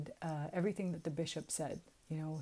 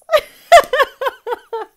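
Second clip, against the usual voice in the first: very high, loud cackle of laughter; shrieking laugh; 24 dB louder than usual. Real, not acted. Amused at her own Southern quip, a loud shrieking laugh. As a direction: break down laughing for real. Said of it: "I'm cracking myself up".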